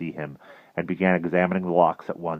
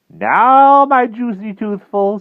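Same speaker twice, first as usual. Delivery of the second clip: high, loud, relishing witch; Madam Mim; 13 dB louder, much slower than usual. A narrator's reading. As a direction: gloat over your prey as a witch.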